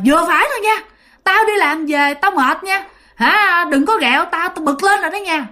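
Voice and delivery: put-on high-pitched, irritated Huế-accented telling-off; imitated scolding in Huế accent. Tired father scolding ghost dogs, acted annoyance in Huế accent. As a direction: act out Dad snapping in Huế dialect. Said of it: "ba mới nạt lên".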